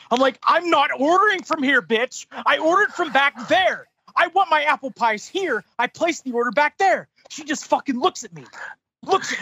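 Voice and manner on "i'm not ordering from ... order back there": higher-pitched, emphatic mock outrage; exasperated argument. Customer arguing with manager over apple pies, exasperated. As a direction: hammer each point home in indignant retelling.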